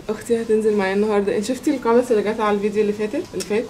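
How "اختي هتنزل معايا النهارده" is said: ordinary talk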